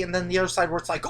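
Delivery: usual speaking voice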